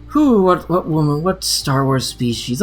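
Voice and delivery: usual speaking voice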